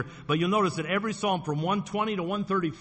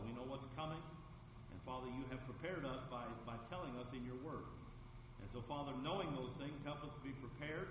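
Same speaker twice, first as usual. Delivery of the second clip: much softer, hushed prayer voice; 2 semitones lower, 20 dB quieter than usual. Real, not acted. Pastor praying for the folks gathered tonight, hushed. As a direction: pray quietly before the message. Prays over the congregation.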